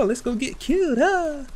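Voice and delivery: high-pitched mock impression; mocking dim-witted characters. Gamer mocks stupid AI allies seeking death, high mocking voice. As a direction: make the fools sound eagerly oblivious, pitched high. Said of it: "We go".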